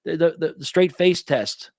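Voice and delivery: high-pitched mock impression; Zuckerberg.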